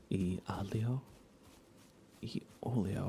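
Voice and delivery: ordinary talk, nothing marked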